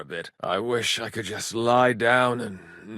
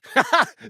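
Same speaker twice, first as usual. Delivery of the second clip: bright burst of laughter; a quick high laugh; short laugh; 5 dB louder than usual. Acted. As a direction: laugh out at the joke. Laughing at a jab.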